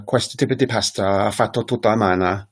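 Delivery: Italian phrase in a sing-song rhythm. Shown as a demonstration. Teacher giving an example phrase of sing-song Italian. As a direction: give it a distinctly Italian rhythm. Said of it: "to me, it sounds very sing-song".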